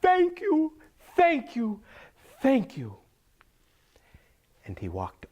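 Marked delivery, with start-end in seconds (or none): 0.0-3.0 very high, loud thanks stepping down in pitch; high-pitched, loud, overjoyed gratitude; Saeed gushing thanks. Acted. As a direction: gush each thank-you in a high farmer voice. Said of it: "And Saeed said".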